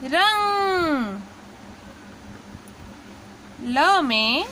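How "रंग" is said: रंग stretched very slowly, nasal ending held; drawn-out syllable. Shown as a demonstration. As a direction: sound out रंग slowly for the class. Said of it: "अंग की मात्रा मिलाएंगे".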